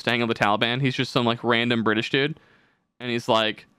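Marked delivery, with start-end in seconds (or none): none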